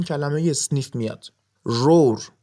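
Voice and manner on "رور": low growled roar; a mock lion's roar. Shown as a demonstration. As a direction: roar like a lion, low and full. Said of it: "یا غرش شیر".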